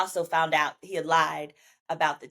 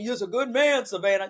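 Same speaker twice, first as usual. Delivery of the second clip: mock his whine; 2 semitones higher than usual. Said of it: "I done told that man".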